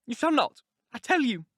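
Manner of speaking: high, loud insistence; wrought-up, commanding heroine; desperate woman. Acted. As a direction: forbid it fiercely, pitched high. Catherine refuses to let him go.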